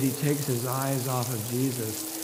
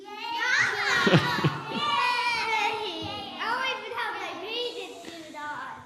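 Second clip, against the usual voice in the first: high laugh; 4 dB quieter than usual. Real, not acted.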